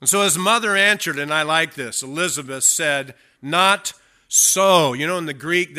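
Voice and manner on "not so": a sharp, louder Not so; emphatic refusal. Acted. Elizabeth refusing the relatives' name, emphatic. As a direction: shut it down flat, no room for argument. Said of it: "that is actually emphatic".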